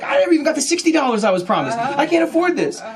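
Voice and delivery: high, loud, hurried complaint; hammed-up outraged pleading; distressed protest. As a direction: whine loudly that it's unfair. Protests the provider's huge bill.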